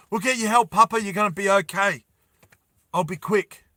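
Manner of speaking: put-on a boy rushing to reassure his father; urgent boy.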